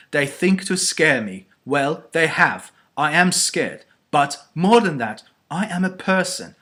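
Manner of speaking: rally yourself against fear with rising indignation; defiant outburst. A narrator's reading. Eleanor works herself up to shout.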